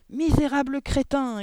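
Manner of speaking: high, loud, drawn-out insult; furious yell. A narrator's reading. Headmistress insulting a failing pupil, furious yell. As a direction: yell it slowly, with outrage. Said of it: "hurla mademoiselle Le Gourdin".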